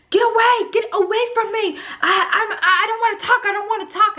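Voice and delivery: high, irritated mock yell; retold yelling. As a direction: re-enact snapping at someone to back off. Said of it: "I would literally yell at them".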